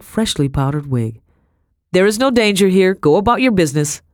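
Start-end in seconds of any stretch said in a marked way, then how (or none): 1.9-4.0 loud, high official command; shouted order. A narrator's reading. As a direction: bark the crowd to disperse. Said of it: "shouted a broad-shouldered man".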